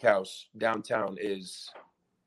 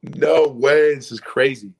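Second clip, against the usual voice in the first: high, loud disbelief; amazed outburst; 3 semitones higher, 10 dB louder, slower than usual. Retold or imitated. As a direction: retell it as a thrilled exclamation. Retold amazed reaction to news.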